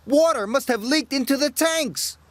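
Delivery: alarmed cry. Acted. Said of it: "he cried".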